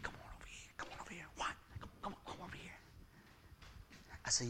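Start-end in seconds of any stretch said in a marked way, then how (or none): 0.0-2.8 hushed, barely audible whisper; secretive whisper. Retold or imitated. Acting out calling the partner over, whispering.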